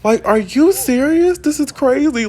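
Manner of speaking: high, loud, retold outrage; shocked fury after being slapped; outraged disbelief. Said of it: "Naomi's like".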